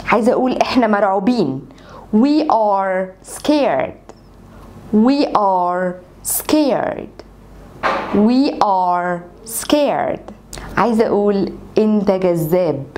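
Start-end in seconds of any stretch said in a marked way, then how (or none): 2.1-10.3 very slow, stretched repetitions; slow drill. Shown as a demonstration. Teacher drilling the sentence for students, very slow. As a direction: say it slowly three times. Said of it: "احفظ الجملة زي ما أنا بقولها لك كده".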